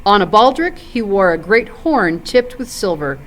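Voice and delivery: plain reading voice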